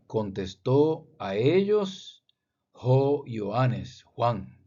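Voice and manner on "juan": ordinary delivery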